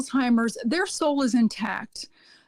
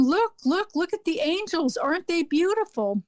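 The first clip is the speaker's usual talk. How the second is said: lightly voiced, higher-pitched wonder; a hint of the patient's awe, pitched up; imitating an awed patient; 5 semitones higher than usual. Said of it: "she was saying".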